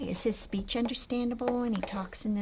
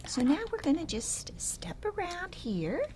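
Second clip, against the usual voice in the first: put-on reading to a toddler. Picture naming with toddler.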